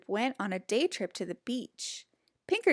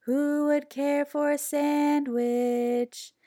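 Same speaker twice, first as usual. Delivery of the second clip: slow sing-song call; closer, louder voice; 5 semitones higher, slower than usual. A narrator's reading. Hidden creature calling the pigs closer. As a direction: lure them in slowly. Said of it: "closer and louder this time".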